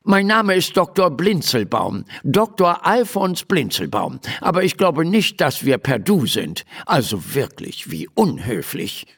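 high, fussy gnome doctor dropping into a miffed grumble; pompous little doctor, affronted. Acted. Little doctor corrects Emma for being too familiar, affronted.

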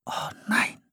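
dismayed whisper. Acted. Philipp whispering as the settlers gather. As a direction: breathe out the dread. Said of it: "flüsterte Philipp".

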